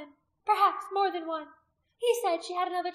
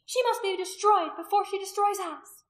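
high, loud urgent warning; consternation; 11 semitones higher, 10 dB louder than usual. A narrator's reading. Priestess urging the woman's destruction, in consternation. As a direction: urge them on in alarm. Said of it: "Anomia said in consternation".

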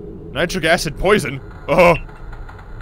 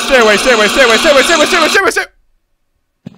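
loud, rising frantic chant; panicked pleading; 5 semitones higher, 11 dB louder than usual. Real, not acted. Player begs the monster to stay away, loud frantic chant. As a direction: beg it to stay away, faster and higher.

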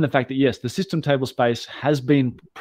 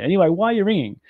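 irritated snap; 8 semitones higher, 4 dB louder, faster than usual. Retold or imitated. Snapping at wife on phone.

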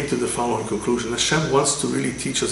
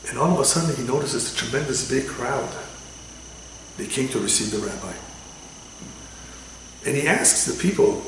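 normal talking voice

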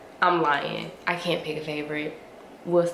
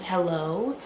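ordinary talk, nothing marked

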